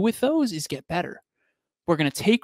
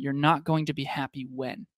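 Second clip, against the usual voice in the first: ordinary delivery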